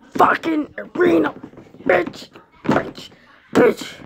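ordinary talk

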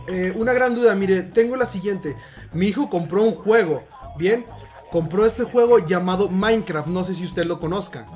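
ordinary talk

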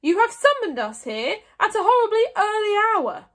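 loud, high-pitched amazed outburst. A narrator's reading. Mogadon complaining to Stoic about the early summons, amazed. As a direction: exclaim in outraged amazement. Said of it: "said Mogadon in amazement".

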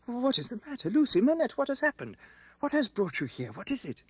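high-pitched, flustered volley of questions; breathless, confused. A narrator's reading. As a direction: fire off alarmed questions, short of breath. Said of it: "breathless and confused".

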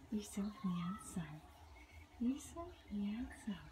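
soft whisper to the cat; cooing whisper to a sleepy cat. Real, not acted. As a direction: whisper it tenderly to a pet.

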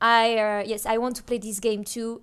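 low, soft English with a French accent. Real, not acted. French gamer talking to viewers in English with a French accent. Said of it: "switches to broken English".

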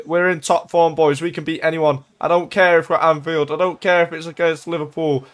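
ordinary talk

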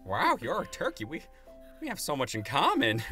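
high, gushing turkey voice; eager, swooping character voice; smitten turkey. Acted. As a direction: gush over a new acquaintance. GM voices turkey meeting a date.

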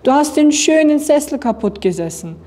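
reproachful little girl, lightly voiced; scolding. A narrator's reading. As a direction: tell off the culprit. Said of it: "schimpfte Anna".

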